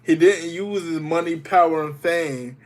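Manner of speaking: ordinary delivery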